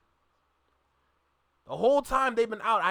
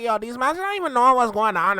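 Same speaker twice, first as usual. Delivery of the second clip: nasally, higher-pitched Toronto slang accent; 4 semitones higher, 5 dB louder than usual. Shown as a demonstration. Imitating Toronto mandem for listeners, nasal Toronto accent. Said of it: "And it's very nasally".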